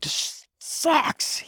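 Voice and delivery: high, breathy whispered groan of disgust; a whispered, squeaky "this sucks"; exasperated whisper. Retold or imitated. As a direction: hiss it out as if fed up. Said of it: "I'm like".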